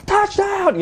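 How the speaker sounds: very high, drawn-out touchdown call; excited radio announcer. Retold or imitated. Imitating the game's radio announcer at the score, ecstatic yell. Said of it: "he has that radio announcer guy voice down".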